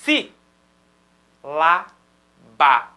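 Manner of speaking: "si, lá, ba" one syllable at a time, long gaps; sillabe staccate. Shown as a demonstration. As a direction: punch out "si, lá, ba" as three separate syllables. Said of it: "cada sílaba que você fala".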